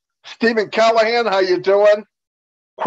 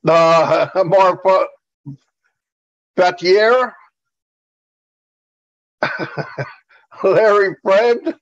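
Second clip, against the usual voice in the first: normal talking voice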